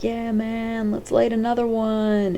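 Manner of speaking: lightly voiced stoner surfer; surfer dude voice. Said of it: "He talks like a surfer dude".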